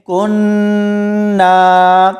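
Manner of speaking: کننا very slow, humming long on the n; tajweed recitation. Shown as a demonstration. Teacher showing the next word, slow sustained recitation. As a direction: hold the nasal n of کننا, then stretch the ending.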